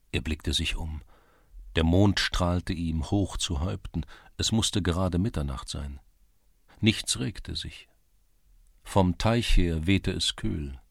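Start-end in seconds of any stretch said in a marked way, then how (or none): none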